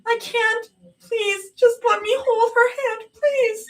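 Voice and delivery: very high, tearful begging; crying please. A narrator's reading. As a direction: beg through sobs.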